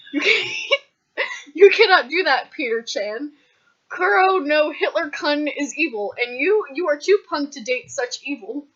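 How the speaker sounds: high, loud, hammed-up anime scolding; screaming in Japanese. A narrator's reading. Megan forbids Peter to date evil, mock Japanese screaming. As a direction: scold loudly like an overwrought anime friend. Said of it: "screamed Megan in Japanese".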